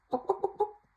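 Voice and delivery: very high, clucking mock chicken. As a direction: cluck like a busy hen. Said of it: "The chicken says".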